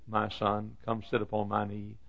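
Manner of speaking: soft, tender invitation; cooing mother. Acted. Mother inviting her son onto her knee, soft cooing. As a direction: coo it quietly to a child. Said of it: "she cooed".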